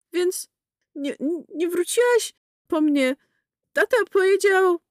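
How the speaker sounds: put-on high, hurt child's voice; high boy's voice breaking into accusation; upset boy. A narrator's reading.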